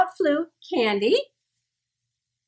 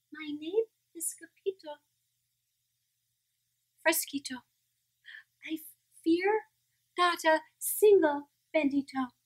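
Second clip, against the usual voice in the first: tiny, soft and timid; wee soft voice; 9 dB quieter than usual. Acted. Scapito answers the bandito in a tiny soft voice. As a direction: hush it like a scared little dog. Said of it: "in a wee, wee soft voice".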